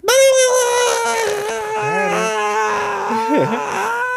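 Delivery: high shrieking laugh shout. Real, not acted. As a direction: burst into a screaming laugh. End of show outburst.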